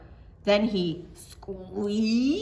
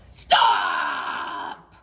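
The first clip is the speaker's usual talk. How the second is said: lower, loud, roaring shout; lion's yell; 6 dB louder than usual. Acted. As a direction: bellow it like a cross lion. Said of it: "yelled the lion".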